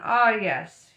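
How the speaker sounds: loud, doubtful agreement. A narrator's reading. Father peering at the baby, doubtful. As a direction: agree without being convinced. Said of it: "he said doubtfully".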